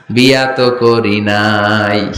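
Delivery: low, drawn-out mock confession; sheepish admission. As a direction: imitate a sheepish man, very slowly. Childless man admits he never married.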